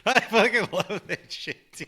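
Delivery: laughing it out, pitched high; cracking up mid-sentence. Real, not acted. Reacting to the clip with his cohost, laughing while he talks.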